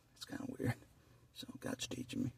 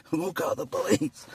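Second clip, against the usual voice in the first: loud, high, slow mock plea; mock caller shouting for police; mock panicky caller; 6 semitones higher, 6 dB louder than usual. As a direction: ham up a jittery neighbor. Mocking police-happy callers.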